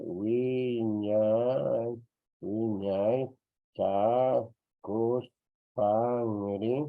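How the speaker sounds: "Wiñay Chacuspangri" very slowly, each syllable held long; teacher's slow dictation of "Wiñay Chacuspangri"; drawn-out syllables. Shown as a demonstration. Teacher sounds word out slowly.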